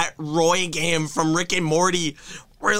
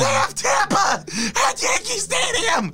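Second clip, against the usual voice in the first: hysterical laughing rant. Real, not acted. Hysterical rant about coming games.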